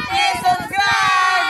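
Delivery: shouted, drawn-out plea; loud slow plea. Real, not acted. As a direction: call it out slowly and loudly. Closing shout to subscribers.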